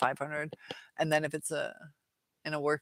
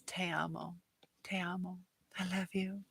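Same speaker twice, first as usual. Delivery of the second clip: hushed, tender-sounding read of a love line; soft mock serenade; 11 dB quieter than usual. Streamer reading Nathan's chat line as a soft serenade. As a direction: breathe it like a playful crooner. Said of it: "Nathan in Spanish says".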